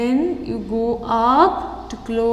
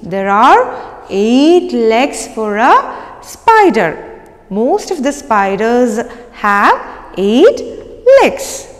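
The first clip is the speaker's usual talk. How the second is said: slow teacher voice. Shown as a demonstration. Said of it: "teacher stressing the number".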